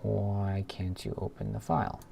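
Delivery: ordinary delivery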